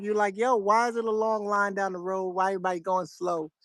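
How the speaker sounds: normal talking voice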